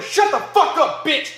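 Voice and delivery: high-pitched furious command; heated, high acted outburst; angry yell. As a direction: yell it, each word drawn out. Acted yell during a fight.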